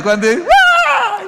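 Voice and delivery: high drawn-out scream of fright; mock startled scream. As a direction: shriek like a scared bodybuilder. Imita susto en el gimnasio. (grito fingido de susto)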